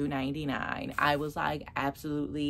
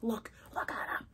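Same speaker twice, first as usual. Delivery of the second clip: whispered; 3 semitones higher, 7 dB quieter, faster than usual. Retold or imitated. Retold whisper to her mom.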